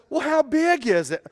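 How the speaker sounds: high, loud curious question; wife calling back. Retold or imitated. Wife asking husband how big the snake is, high shout. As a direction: call it across the yard, calm curiosity.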